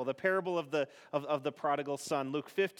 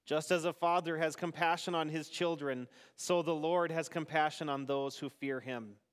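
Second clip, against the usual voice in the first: responsive psalm reading. Acted. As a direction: lead the psalm and leave room for the congregation.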